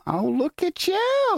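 high, slow, teasing hometown welcome; mock Boston greeting. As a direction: greet him like a sarcastic local. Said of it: "what I meant when he went home".

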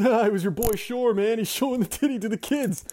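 loud, high, hammed-up gossip; gleeful superintendent. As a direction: crow the scandal to a newcomer. Imitating superintendent spreading the gossip.

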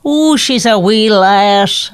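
slow, hammed-up Irish accent; Irish lady. Asked by partner to do an Irish lady, mock Irish accent. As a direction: play an Irish lady, stretched out and about to giggle. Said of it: "now do an Irish lady".

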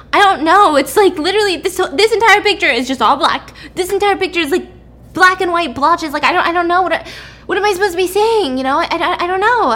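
high, loud, flustered protest; agitated. Acted. Test subject refusing to look at pictures, agitated. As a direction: push it out high and exasperated. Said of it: "She's very agitated".

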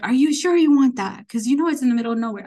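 loud, doubtful question, then a quick warning; incredulous warning. Retold or imitated. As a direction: warn them off, emphatically. Official doubts her choice of post.

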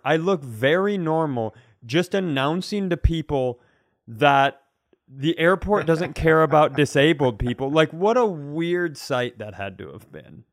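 ordinary delivery

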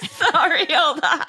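words breaking into laughter; can't get through the line without laughing. Real, not acted. Reading scam messages, cracking up.

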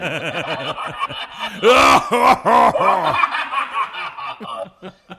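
big helpless burst of laughter; loud laughter. Real, not acted. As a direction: crack up loudly at the voice. Laughing at the scary voice.

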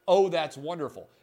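feigned enthusiasm, plainly not meant; sarcastic. Retold or imitated. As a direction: punch it up with obvious sarcasm. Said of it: "That's a sarcastic comment".

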